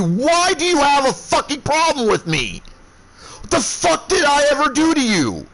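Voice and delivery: shouted high and hard; enraged roaring demand; furious yelling. Retold or imitated. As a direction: blow up at him, full fury. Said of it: "I am livid".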